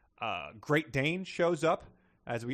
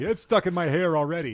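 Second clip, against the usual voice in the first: laughing; 6 semitones higher, 8 dB louder than usual. Retold or imitated. Voicing girl in book.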